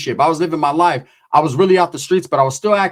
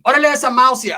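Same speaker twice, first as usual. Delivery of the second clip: Chicano greeting; 9 semitones higher, faster than usual. Retold or imitated. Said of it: "her prima was esa mousy".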